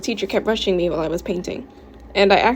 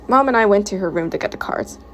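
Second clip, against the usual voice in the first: usual speaking voice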